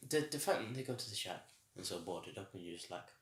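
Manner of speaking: usual speaking voice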